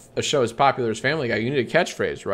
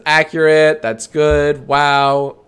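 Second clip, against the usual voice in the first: reading chat; 4 semitones higher, 8 dB louder, much slower than usual. Real, not acted. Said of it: "the chat says".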